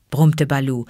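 plain reading voice

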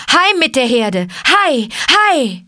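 loud, high drover's cries; herding shouts. Acted. Mowgli driving the buffalo home with Akela, shouting. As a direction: holler to drive the herd on. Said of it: "schrie er".

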